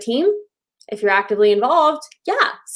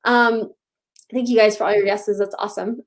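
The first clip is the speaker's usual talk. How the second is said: normal talking voice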